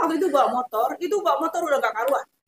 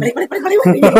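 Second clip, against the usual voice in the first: louder, hurried repeats of balik; loud shooing; 3 semitones higher, 8 dB louder, much faster than usual. Retold or imitated. Teacher shooing pupils home, loud and hurried. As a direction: chase the kids out.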